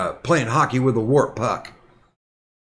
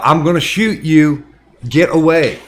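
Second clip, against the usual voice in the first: mild mock yell, a bit louder; half-raised voice, imitating a woman yelling a threat; retold yelling; 5 dB louder than usual. Old lady warning an intruder at her window, retold yelling. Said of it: "she kept yelling".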